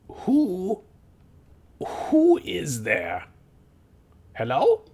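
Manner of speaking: high, drawn-out call into the dark; a goblin warily calling to an unseen stranger. Acted. Goblin calls out to intruder.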